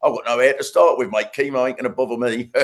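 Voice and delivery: cockney bloke. Retold or imitated. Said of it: "he'd be like".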